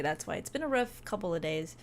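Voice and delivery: ordinary delivery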